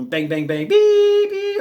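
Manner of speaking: spoken bangs, then long high loud beep; imitated gunfire and beeper. Playing war with blanks and laser gear, imitated shots and beeping. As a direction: mimic shots and a piercing electronic tone.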